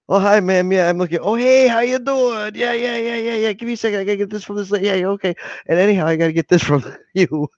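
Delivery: a high, friendly, unhurried take on a chat regular; Miles impression. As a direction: play an easygoing guy answering the chat while doing audits. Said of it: "I like Miles' voice".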